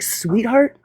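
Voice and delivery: "Sweetheart?" rising high like a question. Retold or imitated. Retelling the panel spat, a rising questioning "Sweetheart?". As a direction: ask "Sweetheart?" with a lifted, doubtful tune. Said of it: "Or no, I said".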